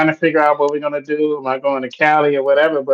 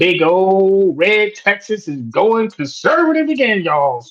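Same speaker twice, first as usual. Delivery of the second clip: higher-pitched put-on Texas accent; hammed-up, not-quite-there Texas twang; 4 semitones higher than usual. Host telling co-host Texas is going conservative, hammy Texas accent. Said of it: "I know my Texas accent ain't quite there yet".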